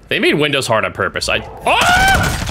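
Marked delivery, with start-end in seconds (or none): 1.6-2.4 sudden very high cry; high-pitched startled shriek; startled yelp. Real, not acted. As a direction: jump and yelp in fright. Startled mid-sentence in game.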